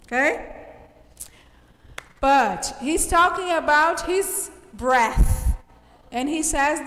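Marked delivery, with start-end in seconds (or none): none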